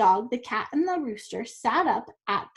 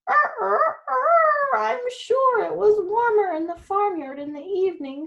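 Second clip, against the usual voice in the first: very high, loud crow then higher wistful line; crowing rooster; 9 semitones higher, 4 dB louder than usual. Acted. Rooster crows then wishes he were back in the farmyard, wistful. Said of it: "sighed the rooster".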